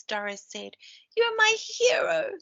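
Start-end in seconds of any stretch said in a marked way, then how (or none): 1.2-2.3 high, loud declaration; declared. A narrator's reading. Reading to children, toad declares the hedgehog his hero. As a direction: toad gushing his thanks, much higher and louder. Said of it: "Toby declared".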